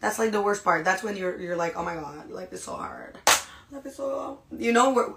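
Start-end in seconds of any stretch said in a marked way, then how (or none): 1.8-4.4 exhausted, hammed-up complaint, much softer; super dramatic. Stressed, tired person complaining to themselves, super dramatic. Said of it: "I'm super dramatic, by the way".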